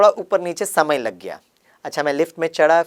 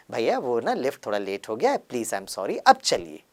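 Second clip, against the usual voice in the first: softer and lower, gentle and sweet; calm the driver with kindness; lovingly; 4 semitones lower, 5 dB quieter than usual. Shown as a demonstration. A sweet apology to the rickshaw driver, soft and gentle. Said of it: "वही dialogue प्यार से बोल सकता था".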